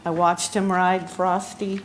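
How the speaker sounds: normal talking voice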